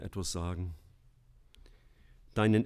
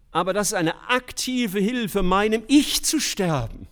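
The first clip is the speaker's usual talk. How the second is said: emphatic preaching; 11 semitones higher, 7 dB louder than usual. Real, not acted.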